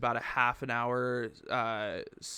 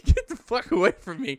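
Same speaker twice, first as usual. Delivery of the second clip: high, loud comic recoil; mock-panicked rebuff; 9 semitones higher, 6 dB louder than usual. Man retelling fending off the grabby bar owner, mock-panicked. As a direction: replay fending someone off, voice pitched up.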